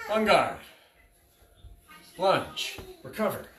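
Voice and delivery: sharp, loud drill calls; crisp fencing commands. Shown as a demonstration. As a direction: call each move to the class.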